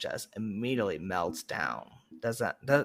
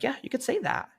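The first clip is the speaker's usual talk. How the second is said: put-on kid voice; 14 semitones higher, faster than usual. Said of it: "I'm like".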